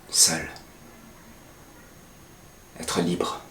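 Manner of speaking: soft, breathy, drifting words; hushed whisper. Acted. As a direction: whisper each word like a dream. Dreamy hushed turn in monologue.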